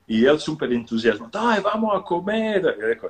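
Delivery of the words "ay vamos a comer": eager invite. Retold or imitated. Enthusiastic actor invites to dinner.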